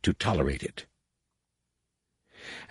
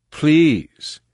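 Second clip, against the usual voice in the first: louder, higher desperate please; urgent plea; 8 semitones higher, 5 dB louder than usual. Acted. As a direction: beg harder, voice rising. Jason begs again, more urgently.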